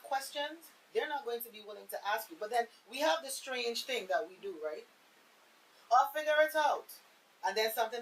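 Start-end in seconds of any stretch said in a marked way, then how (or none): 5.9-6.8 put-on higher, louder defiant child voice; mock stubborn child. Host mimics a child who won't ask for help, defiant. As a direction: toss it off like a stubborn kid.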